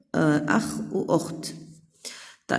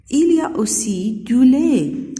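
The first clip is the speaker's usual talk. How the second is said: Sarah speaking; 6 semitones higher, 6 dB louder, much slower than usual. Acted. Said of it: "سارة".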